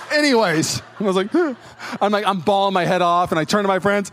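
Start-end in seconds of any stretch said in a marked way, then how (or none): 1.4-2.0 sharp high sobbing gasp; tearful gasp. Retold or imitated. Turning to friends while bawling, high sobbing gasp. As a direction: gasp in through tears.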